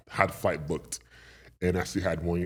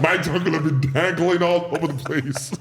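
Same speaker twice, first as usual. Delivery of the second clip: loud, high, laughing exclamation; laughing embarrassment; 9 semitones higher, 7 dB louder than usual. Real, not acted. Wrestler telling his ripped-pants story, loud laughing delivery. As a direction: crack up while saying it.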